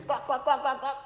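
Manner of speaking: soft high clucking like a hen. Shown as a demonstration. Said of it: "hen says".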